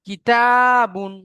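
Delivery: a teacher drawing out কিতাবুন for students to copy; drawn-out model reading. Shown as a demonstration. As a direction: model কিতাবুন slowly and loudly for the class. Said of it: "তারপরে দেখেন".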